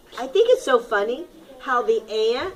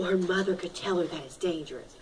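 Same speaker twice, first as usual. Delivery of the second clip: hushed aside; 7 semitones lower, 10 dB quieter, faster than usual. Acted. Teacher drops her voice, softer and quicker. Picture book read to class.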